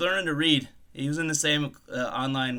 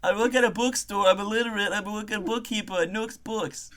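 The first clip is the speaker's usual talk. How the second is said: mildly raised, pleading comic voice; accused bookkeeper; 7 semitones higher than usual. Acted. Suspect explaining the bookstore job, lightly voiced indignant protest. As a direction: protest your innocence with hammed-up earnestness.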